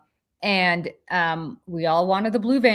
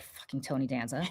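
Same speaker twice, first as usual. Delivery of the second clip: much softer muttered curse; passing the restaurant, quietly annoyed; grumbled curse; 5 semitones lower, 11 dB quieter than usual. Retold or imitated. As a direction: curse him under your breath. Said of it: "I'm like".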